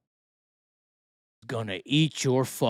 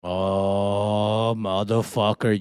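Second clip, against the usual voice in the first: ordinary delivery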